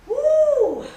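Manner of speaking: excited whoop. Acted. Ladies at intermission.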